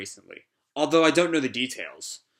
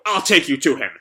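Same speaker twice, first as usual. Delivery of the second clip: impassioned yell, louder; Shiki; 5 dB louder than usual. Acted. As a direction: call it out with determination. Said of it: "yells at the video".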